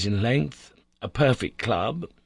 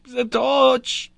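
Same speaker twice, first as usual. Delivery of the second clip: much higher and louder frantic shout of torch; wild cry; 6 dB louder than usual. A narrator's reading. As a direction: scream it out, panicking. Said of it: "cried the professor wildly".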